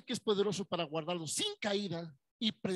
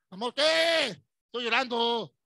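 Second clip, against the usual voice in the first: loud, high, wailing at her husband; hammed-up tearful wife yelling; mock crying yell; 5 semitones higher, 12 dB louder, slower than usual. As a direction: shout it like a sobbing wife. Wife yelling in acted skit.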